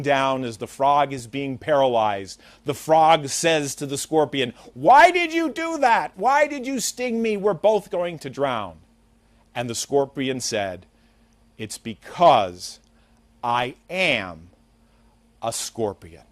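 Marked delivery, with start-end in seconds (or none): 4.7-8.8 high-pitched, acted cry of dismay; distressed cry. Drowning frog cries out to scorpion. 11.6-16.2 slow, low reply with long pauses; measured, matter-of-fact punchline; deliberate answer. Acted. Scorpion's punchline answer in fable.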